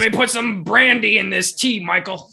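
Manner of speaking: loud, high mock tea-party host. Co-host voicing a tea party with Michael, loud and high. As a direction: play a cheery host serving brandy tea.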